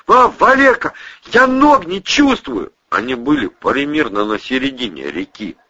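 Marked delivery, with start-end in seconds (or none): 0.1-2.7 frightened call. Acted. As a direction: call out freezing and scared. Freezing man calls friend.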